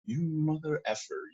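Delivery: muttered soft curse, a bit slow; mock angry curse under breath. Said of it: "in my mind, guess what I started saying".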